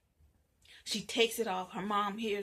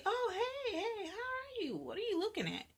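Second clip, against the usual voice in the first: high, startled greeting; 13 semitones higher than usual. Acted. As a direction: caught off guard, squeak it out. Startled, high-pitched reply.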